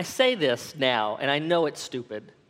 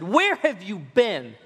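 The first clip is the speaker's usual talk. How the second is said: acted outburst, slow and higher; pent-up anger; 4 semitones higher, 3 dB louder, slower than usual. Husband confronts wife at last, pent-up anger. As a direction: drag out an indignant demand. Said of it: "my pent-up anger kinda let loose".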